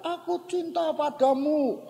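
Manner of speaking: high, slow sing-out call; father demonstrating a call. Retold or imitated. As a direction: show a child how to call across a ravine, drawn out. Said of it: "Sekarang kamu coba bilang".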